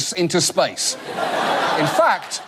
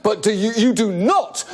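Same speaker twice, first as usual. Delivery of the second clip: ordinary talk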